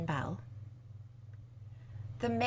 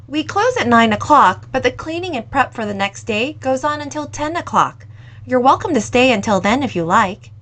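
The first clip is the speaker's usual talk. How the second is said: put-on louder, friendly young woman voice; a lively young cafe owner, voiced louder; 3 semitones higher, 9 dB louder than usual. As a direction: owner kindly offering the lingering customer more time, louder.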